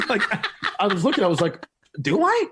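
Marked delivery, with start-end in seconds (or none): 2.0-2.4 very high, disbelieving little question; incredulous. Retold or imitated. Comic answering Travolta's suit compliment, incredulous. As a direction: squeak out mock flattered disbelief.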